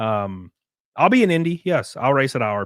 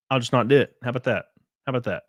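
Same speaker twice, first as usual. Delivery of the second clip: ordinary talk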